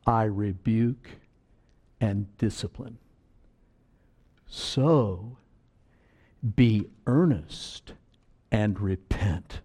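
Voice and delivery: unhurried, deliberate verse reading; slow scripture reading. Acted. Preacher closing the verse for the church, slow solemn reading. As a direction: let the verse sink in slowly.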